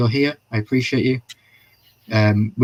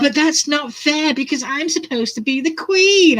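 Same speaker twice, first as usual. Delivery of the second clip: put-on high whiny spoiled girl; 3 dB louder than usual. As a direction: whine it like a spoiled princess. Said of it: "she puts on that whiny voice".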